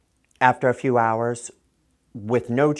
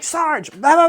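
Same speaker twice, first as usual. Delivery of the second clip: much higher and louder rush of words; excited venting; 9 dB louder than usual. Retold or imitated. To the sergeant about the arrest, high loud agitated venting. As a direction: pour it all out at him, worked up.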